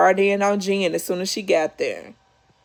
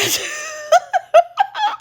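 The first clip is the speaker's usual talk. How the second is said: burst of laughter. Real, not acted. Laughing at the turkey.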